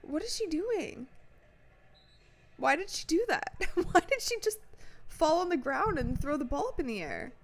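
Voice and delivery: high-pitched, incredulous questions; baffled disbelief. Real, not acted. Streamer baffled by a player's fall, incredulous. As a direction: ask it high, half laughing in disbelief.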